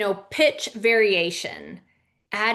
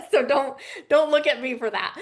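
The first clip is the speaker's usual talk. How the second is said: laughing; 4 semitones higher, 3 dB louder, faster than usual. Real, not acted. Joking about yawning himself.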